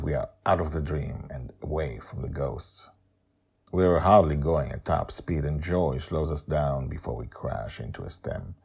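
ordinary delivery

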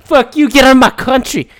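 high, mock-outraged local rejecting a newcomer; hostile local. Locals rejecting a newcomer trying to assimilate, mock hostile. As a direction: imitate an angry local, pitched well up. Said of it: "They're like".

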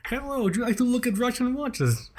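high-pitched mock Russian accent; Vlad. Vlad greeting watch customers, comic Russian accent. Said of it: "Otherwise, I lose accent".

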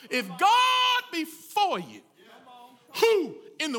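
shouting the promise of faith to the congregation; preaching shout. Real, not acted. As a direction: lean on the word God and shout it out. Preacher builds to the climax.

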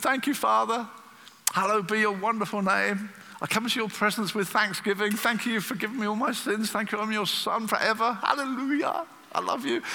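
high, exuberant prayer of thanks; joyful thanksgiving. Acted. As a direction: pray with delighted, rising enthusiasm. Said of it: "I love praying".

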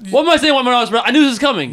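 high-pitched, agitated protest; exasperated outburst. Real, not acted. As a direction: protest loudly, worked up. Outburst at the cancellation news.

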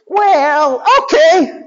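high hesitant cartoon robot; high dim robot henchman. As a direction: reluctantly agree in a high voice. Robots agree.

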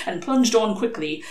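ordinary delivery

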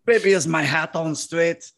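lightly mocking Frenchman with a lisp; a hint of a lisping Frenchman; French accent with a lisp. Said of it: "That's a Frenchman with a lisp".

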